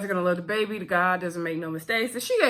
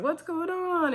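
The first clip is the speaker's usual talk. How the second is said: much higher, lightly voiced caring aunt; mock concerned auntie; 9 semitones higher, slower than usual. Auntie asking sad nephew what's wrong, higher concerned voice. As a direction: pitch up like a fussing auntie. Auntie Yvette checks on Dana.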